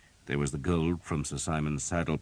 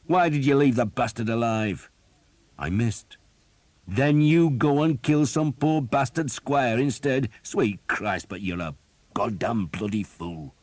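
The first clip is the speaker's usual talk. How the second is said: loud exasperated rant, higher; gruff captain cursing his archer; angry berating; 7 semitones higher, 7 dB louder than usual. A narrator's reading. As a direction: let rip at the fool. Skeet berating Thomas.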